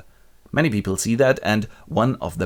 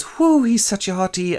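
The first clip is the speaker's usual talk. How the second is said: much higher swooning gossip voice; high mock swoon; 11 semitones higher than usual. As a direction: gush like a smitten girl. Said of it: "and she goes".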